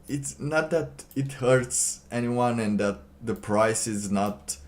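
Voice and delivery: normal talking voice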